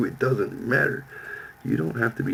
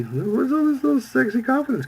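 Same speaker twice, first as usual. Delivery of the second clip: mock teasing girl voice. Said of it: "and I was like".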